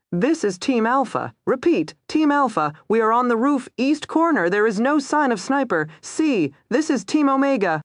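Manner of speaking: high, urgent radio voice; urgent radio call. Acted. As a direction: call it in over the radio, tense. Frantic radio chatter.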